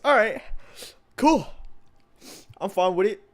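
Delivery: high, loud imitation of a friend taking offence; mock hurt acceptance. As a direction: exaggerate a wounded friend insisting it's okay. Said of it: "Sean just takes that as a personal attack".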